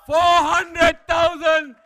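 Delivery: loud, slow auctioneer call to the whole room; calling out a bid. Real, not acted. Auctioneer announcing a record bid to the room, loud. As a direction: boom it out over the hall.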